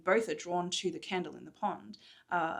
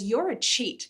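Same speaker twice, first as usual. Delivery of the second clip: accusing; 4 semitones higher, 6 dB louder, slower than usual. Acted. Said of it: "she's remembering what happened".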